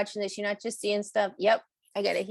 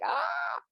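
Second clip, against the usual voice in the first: mock yell. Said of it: "I'm like".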